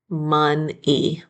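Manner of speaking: Mon-e in two beats, MUN, then EE; syllable by syllable. Shown as a demonstration. Teacher checking the spelling of money, "Mon-e" syllable by syllable. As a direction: leave a small gap inside "Mon-e". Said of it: "Let's spell each syllable".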